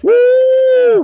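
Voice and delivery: a loud high whoop; preacher's loud whoop; loud high woo. Real, not acted. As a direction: shout a high excited woo. Preacher's exclamation.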